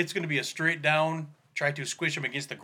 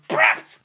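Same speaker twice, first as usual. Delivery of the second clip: hammer impact noise; 8 dB louder than usual. Acted. Hammer hitting the goblin.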